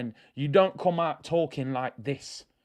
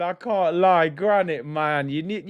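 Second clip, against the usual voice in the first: ordinary talk, nothing marked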